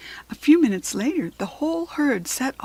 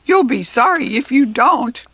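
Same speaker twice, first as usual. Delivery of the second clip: squawking goblin threat; 4 semitones higher, 9 dB louder than usual. A narrator's reading. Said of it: "he squawked".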